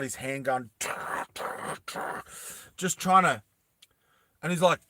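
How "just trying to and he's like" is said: normal talking voice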